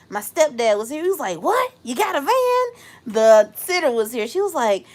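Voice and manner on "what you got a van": high-pitched amazed question; astonished reaction. Retold or imitated. Imitating her stepdad hearing the van news, astonished. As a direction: echo the surprise, pitched way up.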